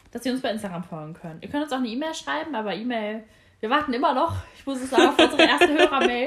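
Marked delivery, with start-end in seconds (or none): 3.6-6.3 laughing. Real, not acted. Jokingly complains, no listener mail.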